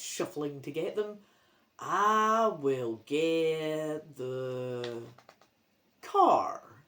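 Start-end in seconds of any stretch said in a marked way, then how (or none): 1.8-6.8 very slow, drawn-out zombie voice; zombie grandpa. Acted. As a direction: stretch every word like a zombie.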